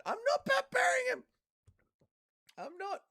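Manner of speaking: squeaky high falsetto denial; comically high-pitched indignant outburst; mock protest. Protesting a viewer's teasing comment.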